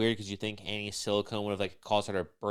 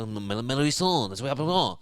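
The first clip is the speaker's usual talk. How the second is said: Frenchie; 3 semitones higher, 5 dB louder, faster than usual. Retold or imitated. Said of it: "And then French will be like".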